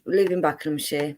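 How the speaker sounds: Buckinghamshire with the h dropped, bakləmshi; her London accent. Real, not acted. Guest reading out her hometown in her own London accent. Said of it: "the difference in the way she spoke then".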